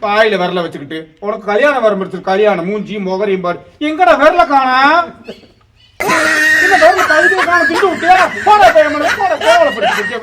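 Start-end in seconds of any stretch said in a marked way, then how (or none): 3.8-10.2 high, loud scolding yell; angry shouting. Acted. Elder woman berating a boy over his finger, shouting. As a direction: shout it in outraged alarm. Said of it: "சும்மா சும்மா கத்திக்கிட்டு இருக்காத".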